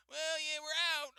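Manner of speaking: high, offhand worker; mock cashier voice. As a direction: pitch it up and shrug it off. Retold cashier reply.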